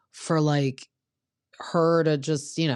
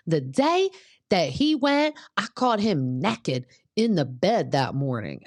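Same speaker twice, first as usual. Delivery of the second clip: put-on Brittany's thick Southern accent; 5 semitones higher than usual. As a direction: mimic a heavy Southern accent, high then settling. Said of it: "Her accent is thick".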